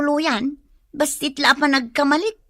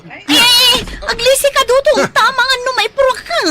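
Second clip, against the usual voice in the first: high, loud urging to run; frantic warning; 10 semitones higher, 7 dB louder than usual. Acted. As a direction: warn him to run, panicked. Warning Dudong to flee. (nadardaras a pakdaar)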